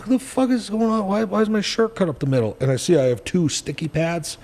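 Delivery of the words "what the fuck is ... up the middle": high-pitched retold disbelief; acting out baffled protest, pitch jumping up; bewildered. Patient finding his shirt cut up the middle, bewildered.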